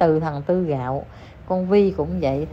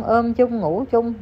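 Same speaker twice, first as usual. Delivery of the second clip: ordinary delivery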